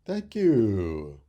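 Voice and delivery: high, loud thankful chirp; grateful birds. Acted. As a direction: thank the boy like happy birds. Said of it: "sang the mother and father".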